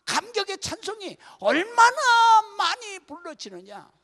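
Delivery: loud, high preaching to the congregation; full-force preaching. Acted. Pastor preaching loudly to the congregation, impassioned.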